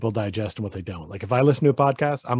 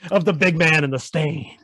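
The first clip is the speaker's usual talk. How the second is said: louder, drawn-out announcer hype; mock show intro; 6 semitones higher, 4 dB louder, slower than usual. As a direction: stretch it out like a hammed-up intro. Mock show intro for their nicknames.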